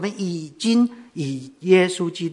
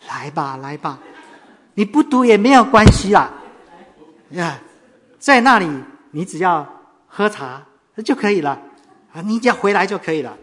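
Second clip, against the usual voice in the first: pleading; 4 semitones higher, 8 dB louder than usual. Retold or imitated. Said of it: "他恳求他说".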